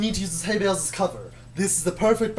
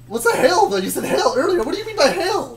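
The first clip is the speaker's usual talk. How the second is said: laughing tease; 9 semitones higher, 6 dB louder than usual. Real, not acted. Teasing partner's slip.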